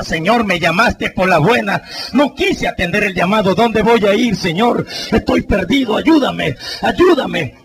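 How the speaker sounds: clama más agudo y fuerte, angustiado; clamor a Dios. Retold or imitated. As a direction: ruega a Dios, perdido y asustado. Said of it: "Decía". (hombre muerto clama al Señor, angustiado)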